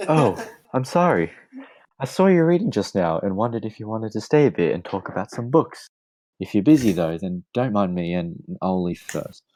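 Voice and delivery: put-on girl voice. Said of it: "I can't do the girl voice".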